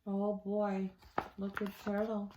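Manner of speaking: put-on soft aside to the kids; quiet aside. Reader chuckling to the kids, softly. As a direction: murmur it to the listeners.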